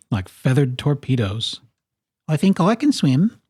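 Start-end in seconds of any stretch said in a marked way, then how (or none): 2.3-3.4 high little bird voice; small character offering a thought; Kiwi. A narrator's reading. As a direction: pipe up high and hopeful. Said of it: "said Kiwi".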